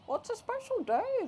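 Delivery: very high, singsong explanation; chirpy nurse. Acted. Nurse explaining to a baffled mom, chirpy singsong. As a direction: pipe it up brightly like a perky nurse. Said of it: "the nurse explained".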